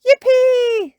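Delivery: very high, loud cheer; gleeful Peppa. Acted. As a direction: squeal with joy like a child. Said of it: "says Peppa".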